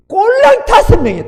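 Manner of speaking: shouted high in amazement; high, loud burst of disbelief; excited shout. Acted. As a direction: exclaim it like it's astonishingly few. Preacher marvels at small number.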